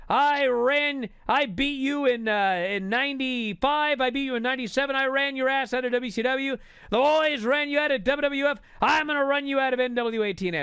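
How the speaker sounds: loud, high wrestling promo rant; Jeff Jarrett ranting. Retold or imitated. Wrestler in the ring threatening his rival, loud high rant. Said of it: "And he's ranting".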